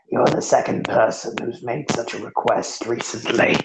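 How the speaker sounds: quiet rasp, unlike the narrator's voice; a short laugh, then rasp it out; rasping figure. Acted. Figure reacts to the dead man's request, rasping. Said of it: "rasping voice that sounds like needles on".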